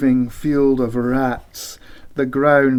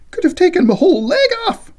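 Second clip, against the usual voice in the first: panicked; 4 dB louder, faster than usual. Acted. After fleeing the huge rat.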